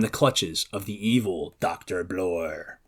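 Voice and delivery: a slow, hushed, sinister build; ominous. A narrator's reading. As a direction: let the villain's name land with dread. Naming the villain in summary.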